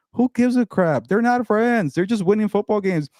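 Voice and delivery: high-pitched exasperated outburst, voice jumping way up; fed-up rant. Real, not acted. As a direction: vent your frustration at full pitch. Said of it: "I'm so tired of".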